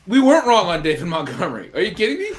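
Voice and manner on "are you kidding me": ordinary delivery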